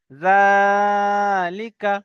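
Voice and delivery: জালিকা with long stretched জা, very slow; slow drawn recitation. Shown as a demonstration. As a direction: recite জালিকা slowly, holding the first aa. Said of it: "বলেন".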